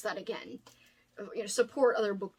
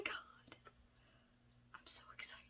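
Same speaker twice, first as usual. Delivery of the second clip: awed whisper; 20 dB quieter than usual. Real, not acted. Overwhelmed thanks to gift sender.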